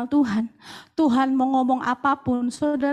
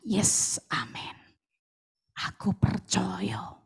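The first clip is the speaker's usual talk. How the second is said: quiet confession; 8 semitones lower, 4 dB quieter, slower than usual. Retold or imitated. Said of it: "saudara pasti berkata".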